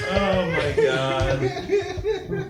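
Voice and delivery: shouted through laughter; laughing. Real, not acted. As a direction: yell it while cracking up. GM laughing at the death.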